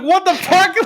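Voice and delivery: very high, squeaky outburst breaking toward laughter; retold shocked outrage. Child told their dog is probably dead, mock shocked outrage. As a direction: shoot the pitch way up in mock shock.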